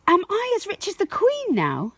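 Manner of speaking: put-on high, quick child's voice asking; a small girl begging to be grown up; eager little girl. Josie asks her parents, high eager child voice.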